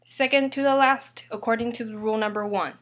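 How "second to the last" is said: usual speaking voice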